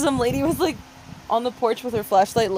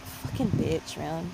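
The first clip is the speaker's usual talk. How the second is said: whispered aside; 6 semitones lower, 9 dB quieter, slower than usual. Real, not acted.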